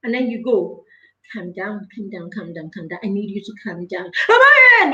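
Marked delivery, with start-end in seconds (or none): 1.3-4.1 soft, low, hushed soothing; soothing calm down. Pretend. Parent softly calming a tantrum, hushed and soothing. 4.3-4.9 put-on high loud child's wail; child's cry. Child crying out to mama, high and loud.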